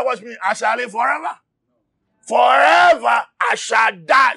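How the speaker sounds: shouted sermon, driving hard; impassioned preacher; fiery preaching. Acted. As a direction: preach it at full force to the church. Preaching at full force.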